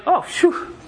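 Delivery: high put-on "oh, phew" of a husband just home; mock weary sigh. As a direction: puff it out like you're worn out. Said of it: "we're like".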